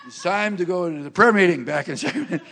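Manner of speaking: loud, laughing hurry-up; hammed-up call to hurry off; mock urgent call. Husband urging wife to leave.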